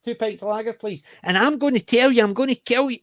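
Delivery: high, loud mock threat; mock aggressive Scots. Scotsman ordering drinks in friendly mock menace. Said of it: "He said".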